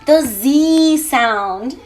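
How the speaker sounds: "Z" named slowly and clearly; z sound. Shown as a demonstration. Teacher slowly names the "Z" sound for the kids. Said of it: "the same z, z, z sound".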